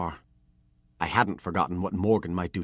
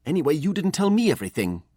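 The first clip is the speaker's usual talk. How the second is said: much higher, louder angry outburst; angry boy; 10 semitones higher, 7 dB louder, faster than usual. A narrator's reading. Hurt apprentice lashes back at his master, higher and angry. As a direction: hurl it back at him. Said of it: "I said angrily".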